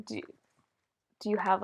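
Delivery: usual speaking voice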